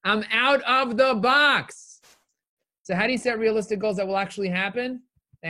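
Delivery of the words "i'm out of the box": much higher, slow and stretched, with a changed voice; drawn-out triumphant boast. Acted. Echoing his own boast to viewers, high and slow. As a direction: sing it out slowly like a victory call.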